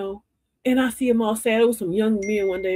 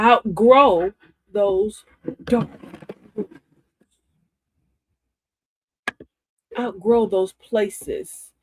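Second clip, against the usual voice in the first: very slow, emphatic, louder on outgrow; preached slogan; 5 dB louder, much slower than usual. Acted. Urging viewers to outgrow dark rooms, very slowly. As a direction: drag out each word.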